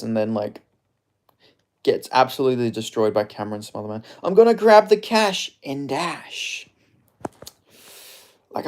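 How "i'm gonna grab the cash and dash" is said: much higher, slow, altered voice; slow high laid-back fighter drawl; J D M impression. Shown as a demonstration. Streamer tries a catchphrase in a slow, much higher Jack Della Maddalena voice. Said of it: "I don't know how to describe his accent".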